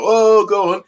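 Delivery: cheering noise. Retold or imitated. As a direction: call it out like egging on a fight. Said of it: "just making noise".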